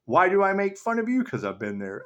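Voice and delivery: ordinary delivery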